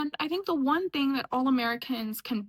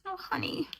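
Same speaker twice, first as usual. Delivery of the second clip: high, soft condescending sympathy; mock pity; 7 semitones higher, 7 dB quieter than usual. Reacting to people bad at geography, soft mock pity. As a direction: coo it softly, pitched up. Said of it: "and I'm like".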